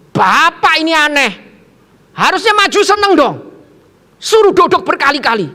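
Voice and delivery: high, loud indignant complaint; mock-angry pupil telling off the preacher; imitated scolding. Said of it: "murid itu justru marahin saya".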